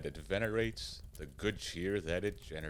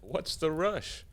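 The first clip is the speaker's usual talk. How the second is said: mock surprise; 9 semitones higher, 4 dB louder than usual. Santa mocks the hurried devil.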